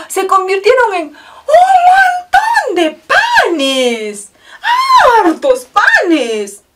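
high, loud, slow, laughing with joy; amazed exclamation. A narrator's reading. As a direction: stretch out the wonder of the bread. Miracle climax. (asombro alegre y fuerte)

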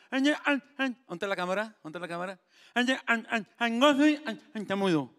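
nasal voice. Acted. Joke teller mimicking the nasal man, high and pinched. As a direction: squeeze it all through the nose. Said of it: "El gangoso y tartamudo".

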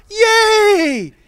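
very high, loud squeal of joy; imitating a cheering child. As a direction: cheer like a thrilled little kid. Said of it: "Lark looked over and said".

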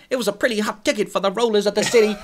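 lightly hammed-up forties noir narrator; mock noir narrator. Quoting noir line with amusement.

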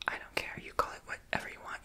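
ordinary delivery

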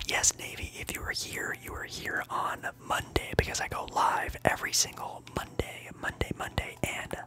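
ordinary talk, nothing marked

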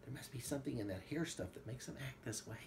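softly voiced, quick confiding line; hushed and hurried, without a full whisper; narrated whisper. A narrator's reading. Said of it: "Seth whispered".

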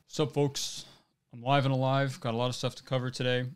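a light take on Hassan's streamer greeting; breezy streamer opener, lightly done; Hassan impression. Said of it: "my best Hassan impression".

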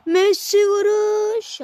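high, loud, slow shot call; excited commentator. Acted.

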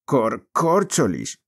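slow, halting oath broken by sobs; a boy's curse choked by sobbing. Acted. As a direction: stumble on the word as the tears catch it. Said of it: "los sollozos cortaban la voz".

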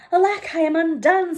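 high, loud wail of despair; despairing cry. Acted. Tailor despairing at the lost twist, crying out high and loud.

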